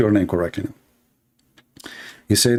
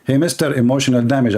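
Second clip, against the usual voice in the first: ordinary delivery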